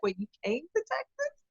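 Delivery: high-pitched puzzled question; mock surprise. Guest quoting people surprised at the move, mock surprise. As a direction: imitate people taken aback.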